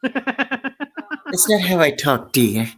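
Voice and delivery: high, drawn-out character voice; put-on character voice. Protesting to a co-host about how they talk, put-on character voice. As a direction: start high and slow, drop on the last word. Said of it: "along with that voice".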